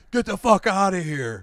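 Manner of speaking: mock angry. Older beach locals chase off outsiders, mock angry. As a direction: imitate locals running outsiders off.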